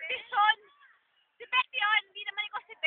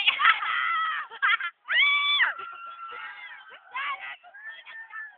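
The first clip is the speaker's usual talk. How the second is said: high, long cackle breaking into squeals; shrieking laughter; 10 semitones higher than usual. Real, not acted. Friends amused at their own screaming, high squealing laughter. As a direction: laugh helplessly until it turns to shrieks.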